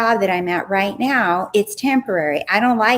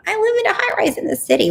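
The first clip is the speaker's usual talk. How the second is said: much higher, fast, self-mocking disbelief; incredulous; 12 semitones higher, much faster than usual. Vlogger mocking her own thoughts, fast and much higher. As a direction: squeak it out in mock disbelief.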